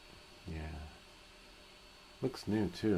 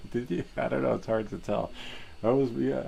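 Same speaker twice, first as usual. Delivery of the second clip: usual speaking voice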